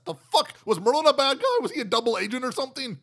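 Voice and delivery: can't believe what the script did; incredulous. Real, not acted. Incredulous at Merlin's plot turn.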